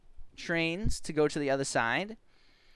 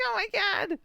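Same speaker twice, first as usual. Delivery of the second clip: high-pitched play-acted dismay; mock shock. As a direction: squeal it in pretend horror.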